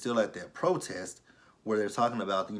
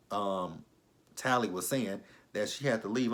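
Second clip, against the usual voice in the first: normal talking voice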